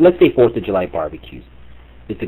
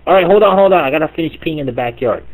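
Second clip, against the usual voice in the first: gruff dad holler; 7 semitones higher, 5 dB louder than usual. Retold or imitated. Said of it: "the dad's like".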